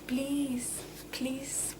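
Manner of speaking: ordinary delivery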